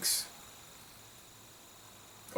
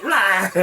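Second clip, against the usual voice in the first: mock roar, louder, through laughter; laughing mock roar; 7 semitones higher, 6 dB louder than usual. Retelling the lady's outburst to viewers, laughing mock roar. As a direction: roar it again while cracking up. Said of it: "it's like".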